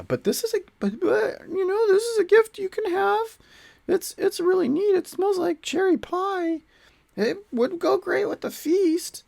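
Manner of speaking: very high, halting squeaky offer; pleading adventurer. Acted. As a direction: timidly sell the gift in a high voice. Adventurer nervously offers gift to orcs.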